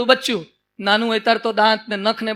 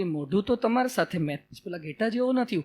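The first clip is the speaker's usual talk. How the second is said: much softer and lower timid voice; small creature insisting shyly; meek lion cub; 3 semitones lower, 11 dB quieter than usual. Acted. Cub shows the lion its ID card, meek and soft.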